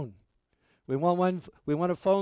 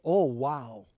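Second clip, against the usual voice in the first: amazed wow; 3 semitones lower than usual. Retold or imitated. Every plane passenger marvelling at flight, mild awe. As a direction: marvel at flying, understated. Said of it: "should always be going".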